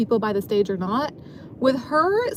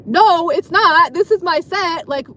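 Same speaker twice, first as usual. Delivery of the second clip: retold indignant protest, starting loud and high; re-enacted argument, voice lifted at the start; raised voice; 4 semitones higher, 4 dB louder than usual. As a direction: push back loudly, then ease off slightly. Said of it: "I raised my voice too".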